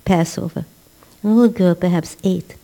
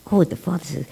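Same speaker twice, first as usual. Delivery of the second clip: normal talking voice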